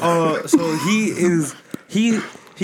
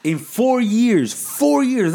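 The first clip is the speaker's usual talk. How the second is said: high, amazed emphasis; excited; 7 semitones higher, slower than usual. Real, not acted. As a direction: stress the short time in awe. Amazed at the short time.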